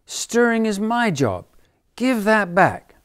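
high, loud squeaky squirrel. Acted. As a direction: squeak it out in protest. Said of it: "squeaked the squirrel".

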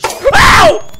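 very high, loud yelp; startled scream. Real, not acted. Player jumped by a game ghost, startled scream. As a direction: yell out in sudden shock.

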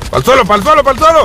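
hyped gamer chant; excited shouting. Real, not acted. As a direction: urge him down, building up. Finishing enemy, climbing excitement.

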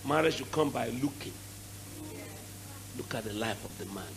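hushed, gentle advice; quiet counsel. Retold or imitated. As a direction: drop low and soft to counsel her. Pastor counsels daughter.

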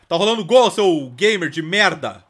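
loud, slowed scolding shout; a dad screaming at his son during a goal; angry yell. Pretend.

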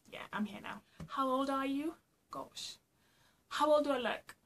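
ordinary talk, nothing marked

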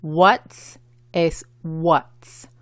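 "What's" as one syllable with an English TS ending; each "What's" drawn out as one syllable. Shown as a demonstration. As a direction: say "What's" slowly as a single syllable, crisp TS at the end. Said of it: "es una sola sílaba".

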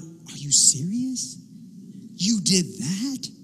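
slow, drawn-out disbelief; mock surprise. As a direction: act shocked at a confession. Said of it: "God is never surprised".

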